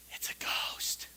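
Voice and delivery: whispered in fear; ghostly whisper. Acted. The disciples in the boat to each other, a terrified whisper. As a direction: breathe it like a frightened secret. Disciples see figure on water.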